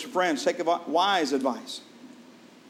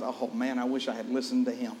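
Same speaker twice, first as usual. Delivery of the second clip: softer, rueful wish; a quiet look back full of regret; softly mock regret; regretful sigh; 4 semitones lower, 5 dB quieter than usual. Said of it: "twenty years later you realize".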